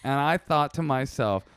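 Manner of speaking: ordinary talk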